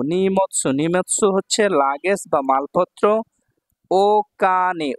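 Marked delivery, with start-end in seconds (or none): 3.9-4.9 ও কানে said very slowly; slow model word. Shown as a demonstration. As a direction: draw out ও কানে. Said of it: "teacher models word slowly".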